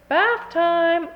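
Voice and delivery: high, loud bath call; mock call. Mother announcing bath time, high and loud. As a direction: sing it out like a bossy mother.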